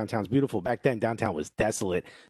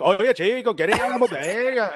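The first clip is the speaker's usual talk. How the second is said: put-on Dominican Spanish accent, higher and louder; 9 semitones higher, 4 dB louder than usual. Around six Dominicans, he catches their Dominican accent. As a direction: slip into a full Dominican accent. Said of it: "all of a sudden I'm like".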